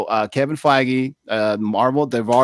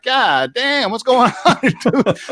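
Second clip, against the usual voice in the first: higher, laughing mid-line in disbelief; 11 semitones higher, much slower than usual. Retold or imitated. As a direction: crack up while asking what's going on. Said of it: "I'm like".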